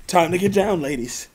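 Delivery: a hint of a sultry stripper cop. Shown as a demonstration. Redoing the stripper line for his cohost, lightly hammed. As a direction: lightly croon it as a party stripper. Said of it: "one more time".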